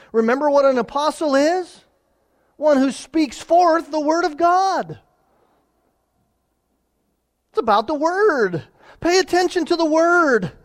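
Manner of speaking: high and loud, pressing the point home; preaching at full force. Acted. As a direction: proclaim it to the whole church.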